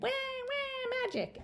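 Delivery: very high mock baby cry; doll baby. Doll baby echoing magic, very high babble. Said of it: "The doll baby said".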